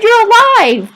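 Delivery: very high, ringing yell; elated shout, pushed right up high; joyful shout. A narrator's reading. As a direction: cry it out in wild relief. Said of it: "I shouted".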